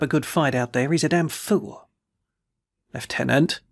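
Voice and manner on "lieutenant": ordinary delivery, nothing marked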